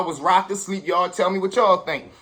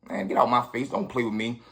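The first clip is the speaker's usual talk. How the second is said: low cold warning; 8 semitones lower, 5 dB quieter than usual. Retold or imitated. Teen warns the older robber off, low menacing threat. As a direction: lower and softer, a menacing growl.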